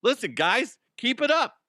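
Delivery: high, loud coach-like urging; mock pep talk. Host addressing the Nuggets players, mock pep talk. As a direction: fire up the team, hammed-up.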